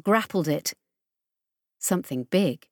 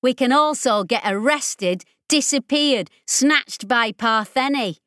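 sharp counter; 5 semitones higher than usual. Acted. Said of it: "Ollie countered".

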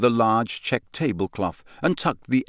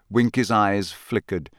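ordinary delivery, nothing marked

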